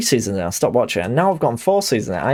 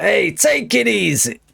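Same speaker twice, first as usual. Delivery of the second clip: higher, slow, drawn-out mob boss calming someone down; a slow, raised Tony Soprano "take it easy"; 3 semitones higher than usual. Retold or imitated. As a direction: play the big mob boss telling someone to chill. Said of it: "if it was Tony Soprano".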